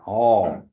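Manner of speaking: "Oh" with a falling tone, drawn out; teacher models a falling tone. Shown as a demonstration. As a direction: let "Oh" drop and lengthen. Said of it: "that tone is going down".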